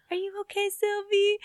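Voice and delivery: put-on high-pitched little-child voice; toddler impression. Mom imitates toddler talking through her tantrum, tiny high voice. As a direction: pipe it up like a two-year-old asking after herself. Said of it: "And then she's like".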